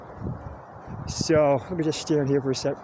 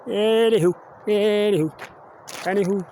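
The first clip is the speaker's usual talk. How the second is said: playful singsong; 6 semitones higher than usual. Pretend. Vlogger switching topic playfully, high singsong fading. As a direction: toss off each anywho, fading.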